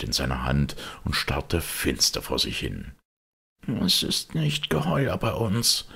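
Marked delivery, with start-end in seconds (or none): 3.7-5.9 dull voice. A narrator's reading. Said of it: "sagte er dumpf".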